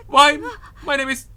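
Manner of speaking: a lightly voiced frightened schoolgirl; shaken schoolgirl. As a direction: play a frightened student introducing herself. Voices the scared schoolgirl Toko.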